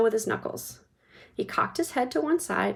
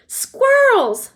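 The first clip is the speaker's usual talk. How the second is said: much higher and louder excited shout; 11 dB louder than usual. A narrator's reading. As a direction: Veruca spotting squirrels, excited shout. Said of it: "shouted Veruca Salt".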